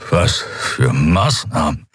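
slow, rasping question; weakened prisoner. A narrator's reading. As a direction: labour over each word, out of strength. Prisoner barely able to stand.

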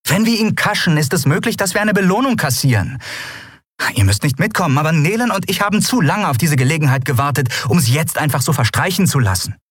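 high, fast, urgent woman's voice; urgent young captain. Acted. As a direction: press the crew to hurry, rushing. Endriel voiced by narrator.

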